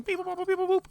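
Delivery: high robotic beep boop; scanner beeps. Pretend. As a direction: chirp like a scanning device. Imitating scanner noise.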